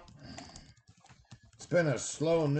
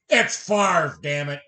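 indignant correction; 2 semitones higher, 9 dB louder than usual. Retold or imitated. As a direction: snap Favre as one syllable, "Farv". Mock-indignant local correcting the mistake.

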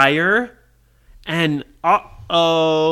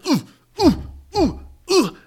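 short high effort grunts; repeated "ooh" with each missed grab; grabbing grunts; 10 semitones higher than usual. Acted. Villain trying to grab the tiny runner, effort grunts.

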